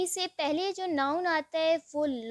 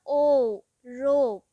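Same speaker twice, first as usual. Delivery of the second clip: रोप said loudly, the ओ stretched long; loud, emphatic teacher demo of रोप; stressed word; 3 semitones lower, 7 dB louder, much slower than usual. Shown as a demonstration. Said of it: "ओ का sound हमारे पास कैसे था".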